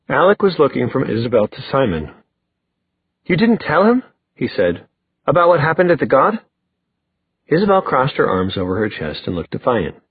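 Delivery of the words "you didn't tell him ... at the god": high incredulous question; pitched-up accusing question. Acted. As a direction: ask in disbelief, voice climbing at the end. Brother challenges sister in quarrel.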